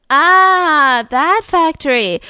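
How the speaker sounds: loud, high, slowly drawn-out "ah"; mock realization. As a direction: play sudden knowing recognition, hammed-up. Police mock knowing realization.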